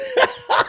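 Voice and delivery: very high staccato cackle; gloating cackle. Real, not acted. Host hinting at what tomorrow brings, gloating cackle. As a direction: cackle with knowing relish.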